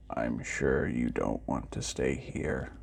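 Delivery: deep, quiet, forlorn robot; sad robot. A narrator's reading. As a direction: speak low and slow like a lonely old machine. Robot speaks in the story.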